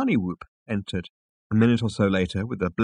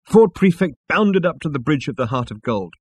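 ordinary delivery, nothing marked

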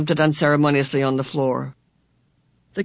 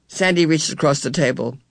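ordinary delivery, nothing marked

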